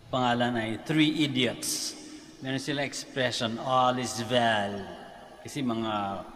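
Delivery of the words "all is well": slow, lilting Bombay-style Indian English; Indian accent. Retold or imitated. Priest quoting the Three Idiots catchphrase in an Indian accent. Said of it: "kaya ganun yung intonation".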